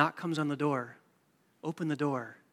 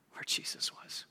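whisper; 7 dB quieter than usual. Real, not acted. Hushed storytelling at the manger.

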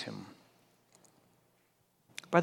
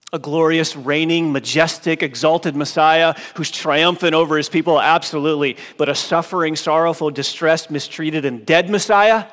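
loud list climbing higher at the end; fervent preaching; 4 semitones higher, 10 dB louder than usual. Acted. Preacher contrasting the glorious and the suffering Messiah, loud. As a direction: build the list to a ringing question.

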